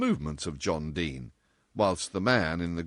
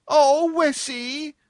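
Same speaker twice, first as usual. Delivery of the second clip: high, slow, louder theatrical wail; 8 dB louder, much slower than usual. Acted. Brunette mourning the lost tea, hammed-up high wail. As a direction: wail it like a tragedy. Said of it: "wailed the little brunette".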